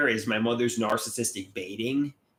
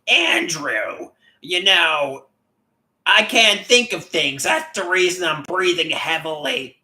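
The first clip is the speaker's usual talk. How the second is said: higher, louder mocking mother voice; imitating mother; 6 semitones higher, 6 dB louder, slower than usual. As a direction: whine it like his complaining mom. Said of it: "she'll say something like".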